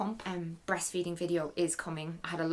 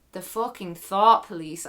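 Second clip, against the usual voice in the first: heated outburst; 8 dB louder, slower than usual. Real, not acted. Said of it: "I'm sorry".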